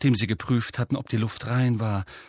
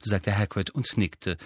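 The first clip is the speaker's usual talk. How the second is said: ordinary delivery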